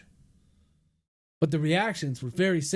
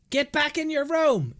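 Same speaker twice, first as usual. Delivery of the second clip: sudden shout; 10 semitones higher than usual. A narrator's reading. Mother ordering her son back upstairs, shouting high. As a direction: yell it again, sharper. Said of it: "Miranda suddenly shouted".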